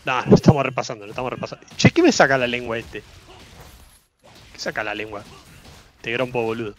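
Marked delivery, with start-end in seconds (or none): 1.0-1.7 softer, half to himself; quieter repeat. Real, not acted. Streamer mutters the repeat to himself, softer. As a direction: drop the repeat down under the breath.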